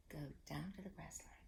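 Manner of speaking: soft unvoiced whisper of Go down to the grass line. Shown as a demonstration. Teacher whispering the echo for the kids. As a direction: breathe the words with no voice.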